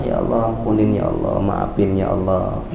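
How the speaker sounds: low humble murmured prayer; pleading prayer. Acted. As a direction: beg God softly, drawing it out. Voicing a repentant sinner's prayer.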